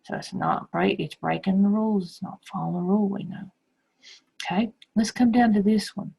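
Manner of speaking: ordinary delivery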